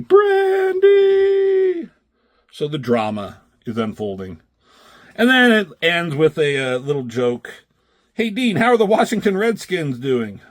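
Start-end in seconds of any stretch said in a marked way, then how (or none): 0.0-1.9 higher, louder, drawn-out anguished yell; yelling name. Retold or imitated. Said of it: "Just yelling". 8.1-10.4 higher, jaunty question; lightly voiced cheerful asker. Reading a comic gag line.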